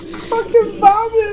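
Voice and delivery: high, slow, laughing shout; laughing exclamation. Real, not acted. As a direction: call the name out through laughter.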